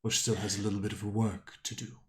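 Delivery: a hint of an ancient being; lightly voiced lightning creature. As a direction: speak as a calm, knowing creature.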